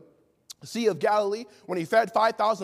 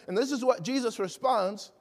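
ordinary delivery

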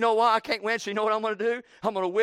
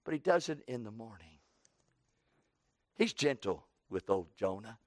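hushed tender; 10 semitones lower, 10 dB quieter than usual. Real, not acted. Hushed repeat of gentle line.

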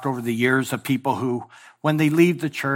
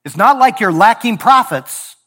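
louder, higher preaching; preaching rebuke; 7 semitones higher, 7 dB louder than usual. Real, not acted. Preacher rebuking the congregation, raised preaching voice. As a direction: drive the point home.

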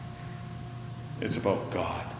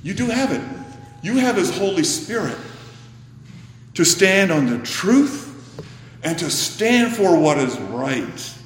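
rousing exhortation; 7 semitones higher, 3 dB louder than usual. Real, not acted. Preacher exhorting congregation.

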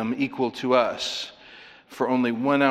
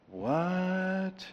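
mock surprise; 6 semitones higher, 6 dB quieter than usual. Mock disbelief at the pay.

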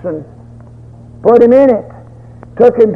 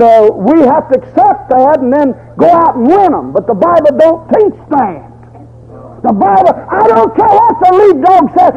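preaching at full force; 6 semitones higher than usual. Acted. Preacher rebuking false doctrine at full force. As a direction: hammer the point home to the congregation. Preaching against hyper-Calvinists.

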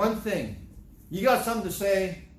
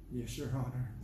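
meek yes; 4 semitones lower, 9 dB quieter than usual. Acted. Humbled defendant answers judge.

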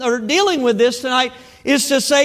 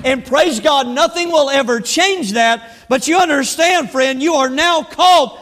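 raised pitch, stretched key words; emphatic preaching; 4 semitones higher than usual. Real, not acted. Preacher urging church to imitate Christ, emphatic preaching. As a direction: preach it firmly, drawing out the weighty phrases.

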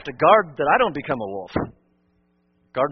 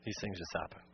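hushed echo; 10 semitones lower, 19 dB quieter than usual. Real, not acted. Sober repeated lament.

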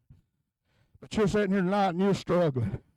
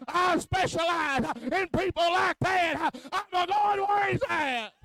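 slow, higher, shouted declaration; Jesus voiced in preaching; 10 semitones higher, slower than usual. Preacher voicing Jesus to the church, a slow shout. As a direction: proclaim it with triumphant authority. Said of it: "But Jesus said".

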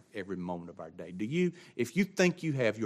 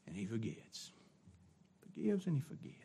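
hushed repeat; 13 dB quieter than usual. Acted. Dropping to near hush.

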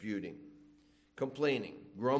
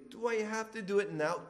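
higher whiny protest; child whining; 6 semitones higher, faster than usual. Retold or imitated. Child protesting to Mom about toys, higher whine. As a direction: whine like a kid asked to tidy. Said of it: "And they say".

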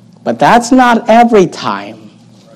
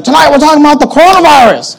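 mock announcer; 7 semitones higher than usual. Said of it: "and it's like".

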